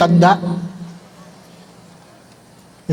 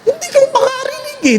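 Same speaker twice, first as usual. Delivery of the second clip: put-on child answering parent.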